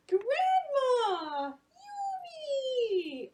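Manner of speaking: high, loud happy shouts; two loud high joyous calls; joyful calls. Acted. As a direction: call out across the road. Characters call out reunited.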